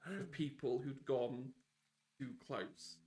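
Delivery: slow reveal with long pauses; game master's scene setting. Acted. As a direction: narrate it slowly, letting each phrase hang. Game master narrates illusion breaking.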